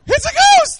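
very high, loud comic shriek; high-pitched scared scream. Acted. Preacher repeating the frightened disciples' cry, high scream. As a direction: squeal it out in mock terror. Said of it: "screaming like women".

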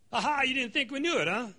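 high, playful aha; teasing gotcha. Acted. Preacher teasing the congregation about Baal worship, playful. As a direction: catch them out with a grin.

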